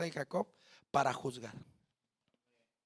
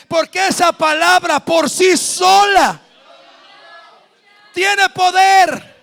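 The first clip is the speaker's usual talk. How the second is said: slow, shouted, higher climax; shouted proclamation; 7 semitones higher, 14 dB louder, slower than usual. Acted. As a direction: hammer it home slow and loud. Clímax de la prédica. (proclamación a gritos, lenta y aguda)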